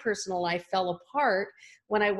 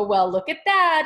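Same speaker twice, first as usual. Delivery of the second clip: higher and louder, thrilled at the total; delighted exclamation; 6 semitones higher, 6 dB louder than usual. Retold or imitated. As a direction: mentor showing the clerk the amount. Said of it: "and she would go".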